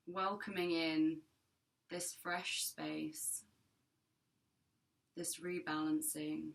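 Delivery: calm, measured meditation voice with long pauses; guided meditation. Acted. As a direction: guide listeners gently into their breath, unhurried and even.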